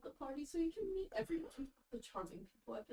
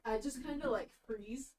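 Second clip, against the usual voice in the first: ordinary talk, nothing marked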